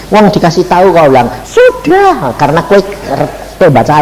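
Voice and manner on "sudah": very high, smug "Sudah"; mocking high voice of the caller. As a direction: toss off a cocky "done already" way up high. Caller's smug reply repeated.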